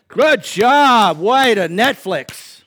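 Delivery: loud, drawn-out sarcastic cheer; slow mocking applause for the couch. Preacher mocking fans who cheer doing nothing, loud sarcastic cheer.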